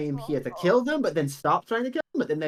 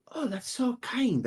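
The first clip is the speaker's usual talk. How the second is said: put-on touched old woman; 8 semitones higher, slower than usual. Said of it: "He goes".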